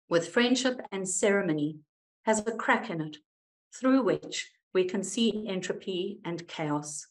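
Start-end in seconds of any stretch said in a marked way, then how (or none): none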